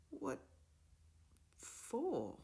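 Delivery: a shocked, half-strangled question; the words barely get out of her throat; choked with shock. A narrator's reading. Titania answers the professor's expulsion with choked shock. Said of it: "in a voice that was so choked with shock".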